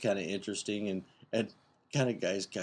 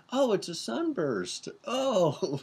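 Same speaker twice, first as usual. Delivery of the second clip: sudden realization; 12 semitones higher, 4 dB louder, much slower than usual. Retold or imitated. Men realize what she means.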